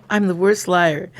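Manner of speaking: ordinary talk, nothing marked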